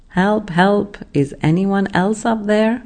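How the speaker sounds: a desperate man calling out, lightly hammed up; mock yell for help. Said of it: "Jack yelled".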